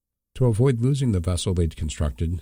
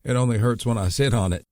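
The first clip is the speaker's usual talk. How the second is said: lightly voiced wounded brother, joking through the pain; a hint of a hurt young man making a joke; 5 semitones higher than usual. A narrator's reading. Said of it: "he joked in pain".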